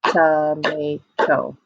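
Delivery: "Tomato" in three slow, even beats; split into syllables. Shown as a demonstration. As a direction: clap out "Tomato" as TO-MA-TO on a level note. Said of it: "Clap again".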